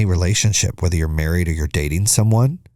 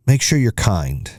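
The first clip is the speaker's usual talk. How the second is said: ordinary talk, nothing marked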